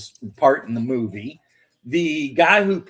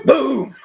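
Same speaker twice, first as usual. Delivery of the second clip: mock explosion boom; 11 semitones higher, 7 dB louder than usual. Explosion sound effect.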